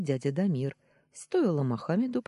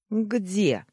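loud, raised, doubting question; skeptical scoff; 7 semitones higher, 6 dB louder than usual. A narrator's reading. Nephew doubting his uncle's boast, skeptical scoff. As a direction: scoff at the claim. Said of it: "скептически хмыкнул я".

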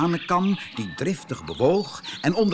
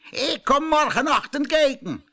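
high, loud farmer warning; stern farmer; 6 dB louder than usual. Acted. Farmer tells the rooster he will check tomorrow, loud. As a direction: announce the check like a threat.

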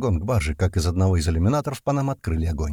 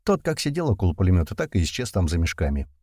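plain reading voice

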